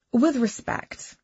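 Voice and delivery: slow, haughty With respect. A narrator's reading. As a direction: say With respect with cool disdain. Tab's haughty phrase.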